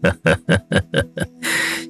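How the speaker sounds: breathy, unvoiced he-he chuckle; hearty old man's chuckle. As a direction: chuckle steadily like a pleased old man. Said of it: "他呵呵地笑着".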